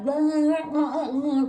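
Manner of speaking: garbled high blabber; imitated muffled blah-blah; trying to talk. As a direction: babble as if the mouth is being worked on. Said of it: "trying to talk".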